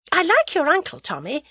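high, loud change of subject; suddenly chirpy, pitched well up; hasty diversion. A narrator's reading. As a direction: jump in high to divert him. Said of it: "hastily creating a diversion".

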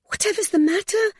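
very high, anxious question, rising; alarmed question. A narrator's reading. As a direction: plead to know what is wrong. Alarmed woman asks the groaning man.

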